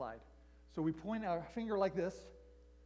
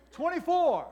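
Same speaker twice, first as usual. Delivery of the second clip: loud call, pitched up; 9 semitones higher, 9 dB louder than usual. Acted. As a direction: bark the number out like a play call. Calling out verse cadence.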